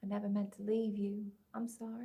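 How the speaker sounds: very soft, fading to near nothing; hushed apology. Acted. Sobbing father apologizing to his daughter, hushed. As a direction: apologize barely aloud.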